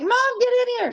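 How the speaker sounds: imitated high-pitched panicked call for Mom; retold freak-out, very high. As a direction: mimic a frantic kid calling out, pitch way up. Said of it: "Kaylee called me freaking out".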